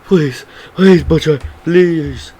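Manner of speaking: high, loud mock begging; drawn-out, high-pitched imitation of begging; Homelander pleading. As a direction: plead for your life, hammed up. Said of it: "Homelander's pleading for his life".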